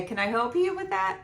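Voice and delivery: high, cooing offer of help; sing-song helpful adult. Retold or imitated. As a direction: talk sweetly to a toddler. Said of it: "Robin and everyone would say".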